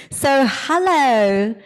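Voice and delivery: normal talking voice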